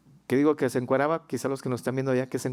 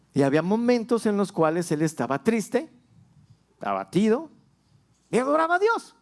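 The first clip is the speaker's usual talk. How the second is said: rising, fervent preaching climbing higher; building sermon; 6 semitones higher than usual. Acted. Pastor preaching to the congregation about David, building fervor.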